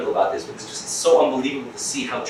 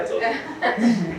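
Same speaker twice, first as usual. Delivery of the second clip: usual speaking voice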